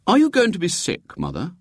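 put-on high, keenly curious boy; with interest. A narrator's reading. William asking his seasick mother, with interest. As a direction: ask with frank interest, pitched high. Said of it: "said William with interest".

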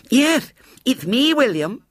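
put-on high, slow little-girl lisp; small shrill voice. A narrator's reading. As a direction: announce yourself in a piping child voice. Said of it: "shrill voice".

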